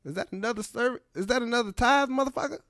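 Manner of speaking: high, incredulous mock Jackson at church; Samuel L. Jackson. Comic voicing the actor at the collection plate, indignant. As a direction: squeak it out, outraged at the plate. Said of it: "he can be like".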